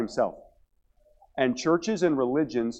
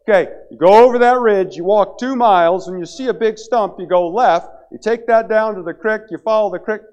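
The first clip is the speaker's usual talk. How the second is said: lazy guide barking orders; 7 semitones higher, 8 dB louder than usual. Retold or imitated. Lazy guide giving orders from camp.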